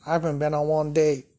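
ordinary delivery

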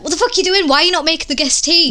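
imitating Sid berating his wife; mock angry husband. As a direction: scold the wife in front of guests. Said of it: "he's like".

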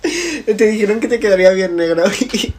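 giggling disbelief while repeating the story; words bubbling out mid-laugh. Real, not acted. Laughing at friend's impulsive dye story.

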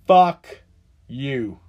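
loud curse. Real, not acted. I've only got two words.

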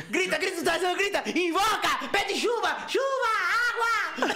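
high, shouted orders to act like Tarzan; hammed-up shouted commands; mock yelling director. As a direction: bellow at him to call the rain, in jest. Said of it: "E ela tá atrás do cara assim, ó".

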